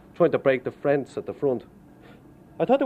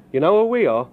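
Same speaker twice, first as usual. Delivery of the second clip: higher, louder imitated policeman demanding; mock cop challenge; 7 semitones higher, 6 dB louder than usual. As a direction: throw it at him like a smug cop. Cop confronting him, retold.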